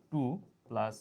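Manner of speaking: plus root three drawn out very slowly; slow dictation. Shown as a demonstration. Teacher dictating the answer slowly to students. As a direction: let each word hang while writing.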